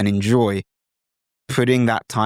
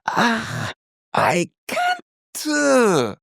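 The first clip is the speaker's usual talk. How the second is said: very high, sung "Ah, I can't"; Korean hesitation sound; 12 semitones higher, much slower than usual. Shown as a demonstration. Tutor showing Korean student's hesitation, very high pitch. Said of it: "I don't know how else to show you".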